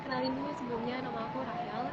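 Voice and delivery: very faint, far from the mic; barely audible. Real, not acted. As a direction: voice too soft to hear. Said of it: "suaranya kecil banget".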